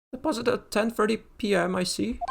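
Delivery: high nervous shopkeeper. Acted. Same pawnbroker character.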